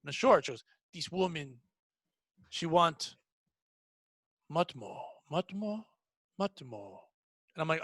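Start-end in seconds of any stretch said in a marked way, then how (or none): 0.9-7.0 put-on soft, halting Eastern European accent; low, Dracula-like Eastern European accent, word by word. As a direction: imitate a puzzled coworker in a thick Eastern European accent. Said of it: "a very hard accent".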